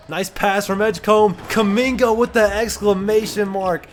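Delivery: loud, high play-by-play burst; excited game-sealing call. Real, not acted. Gamer calling the finishing dunk, excited shout.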